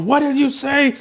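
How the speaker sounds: high-pitched mock outrage; quarrelling. Hothead rival answering an insult, quarrelling. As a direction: flare up at a rival's remark, voice shooting up.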